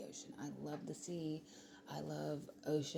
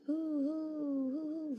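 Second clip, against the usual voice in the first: high, loud hooting owl call, drawn out slowly; a woman calling out to an owl she heard in the dark, hooting loudly; loud owl hoots; 12 semitones higher, 7 dB louder, much slower than usual. Retold or imitated. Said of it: "really loud".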